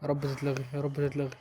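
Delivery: low, soft pleading to God; hushed prayer. Real, not acted. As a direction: murmur the prayer under your breath. Praying the goal gets disallowed. (دعاء هامس منخفض)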